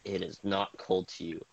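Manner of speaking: dramatic scene-setting narration; game master narration. Acted. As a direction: set the scene like a storyteller. GM narrates the ice cave.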